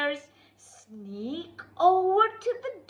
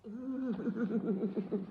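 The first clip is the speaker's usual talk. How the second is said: spooky cackle; 8 semitones lower, 10 dB quieter than usual. Acted. Evil laugh.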